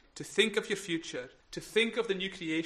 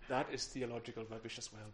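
ordinary talk, nothing marked

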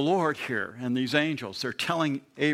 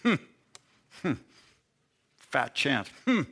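doubtful inner laugh. Retold or imitated. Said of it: "inside she's laughing".

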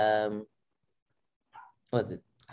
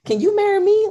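loud, very high playful plea; insistent proposal; 12 dB louder than usual. Retold or imitated. Girlfriend proposing to the winner, loud giddy insistence.

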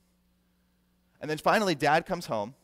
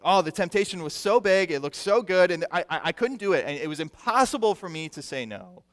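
louder, higher voice of someone giving in; mock excuse-making; 4 semitones higher, 6 dB louder than usual. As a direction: plead that it was too much to resist. Acted excuse of someone tempted.

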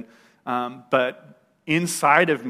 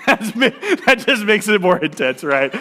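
high, loud burst of real laughter while talking; laughing; 8 semitones higher, 7 dB louder than usual. Real, not acted. Speaker cracking up at his own hospital story, laughing. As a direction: laugh through the words at your own absurd detail.

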